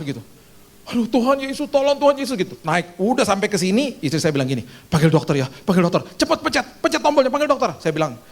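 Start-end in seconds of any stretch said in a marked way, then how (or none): 0.9-2.3 high, pleading cry to Jesus; desperate plea. Retold or imitated. A patient in a fever attack crying out to Jesus, high and pleading. 4.9-7.7 urgent wife. Retold or imitated. Wife urging to call doctor.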